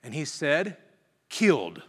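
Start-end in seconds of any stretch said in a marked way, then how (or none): 1.3-1.8 "Killed" hit hard, dropping in pitch; emphatic. Retold or imitated. Student answering what crucified means, said with emphasis. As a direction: punch "Killed" as one lone falling word. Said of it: "He said it with emphasis".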